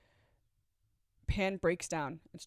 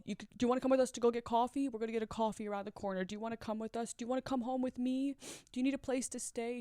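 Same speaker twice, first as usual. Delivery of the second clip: fast, higher pleading; urgent offers; 3 semitones higher, much faster than usual. Retold or imitated. Girl urging her to leave with them, fast. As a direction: rush out the offers of help. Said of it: "She says".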